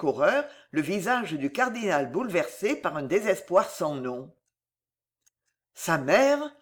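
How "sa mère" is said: plain reading voice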